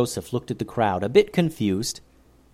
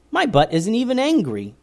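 put-on high eager boy; lightly voiced young boy; 11 semitones higher, 3 dB louder than usual. A narrator's reading. Joseph explaining to the leader, high and eager.

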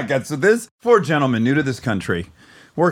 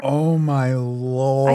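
appalled disbelief; much slower than usual. Real, not acted. Appalled reaction to the ending.

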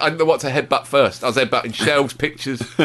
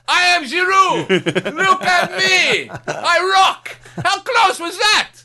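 mock vain striker; 12 semitones higher, much slower than usual. Mocking a vain striker to his co-host, hammed-up and shouting. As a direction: crow about yourself like a vain footballer. Mocking Giroud admiring himself.

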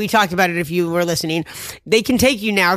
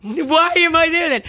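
much higher and slower, incredulous; indignant disbelief; 9 semitones higher, slower than usual. Retold or imitated. Questioning why the hospital held her, indignant disbelief. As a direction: ask it as if the answer is absurd.

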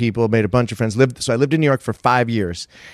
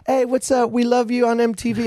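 high-pitched imitation of celebrity fans; excited fans; 12 semitones higher than usual. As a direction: gush in a much higher, put-on voice. Quotes Salt-N-Pepa greeting him.

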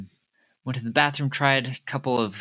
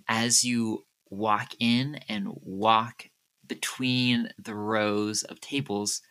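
ordinary talk

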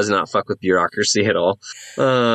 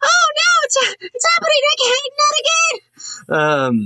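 very high, hammed-up wail; panicked shriek. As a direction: squeal in mock terror, drawing out the end. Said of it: "people start to scream in panic".

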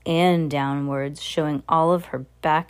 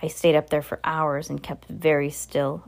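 usual speaking voice

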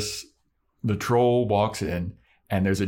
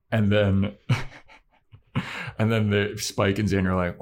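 ordinary delivery

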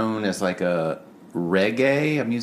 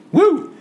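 high, loud "woo"; excited whoop; 9 dB louder than usual. Real, not acted. Co-host cheering the shouted album title, loud high whoop. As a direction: let out a short cheering whoop.

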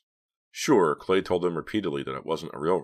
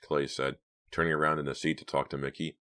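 usual speaking voice

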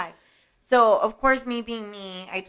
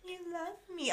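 a hint of a child's voice; 10 semitones higher, 12 dB quieter than usual. Child's voice, soft and high.

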